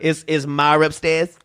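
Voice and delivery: slow Boston accent, "Ma" with an added R. Shown as a demonstration. Repeating the example for a friend, Boston accent. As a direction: drop the R in "upstairs", Boston-style. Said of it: "you drop the stairs".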